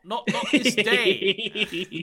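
high, slow stammering radio king; stuttering George the Fifth. Retold or imitated. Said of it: "That is actually an impression of George Fifth".

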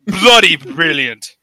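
put-on slow, savoured British accent; mock British accent. Podcaster praising the idea, mock British accent. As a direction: put on British, drawing the words out. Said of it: "you got a permit to be that British".

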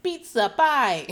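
loud, high cartoon Italian; hammy Italian accent. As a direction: shout it like a sitcom Italian. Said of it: "playing this very Italian man".

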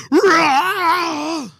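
sudden high yelled "Rah!"; imitating a loud roar. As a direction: bark out a mock blast of noise. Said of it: "You're like".

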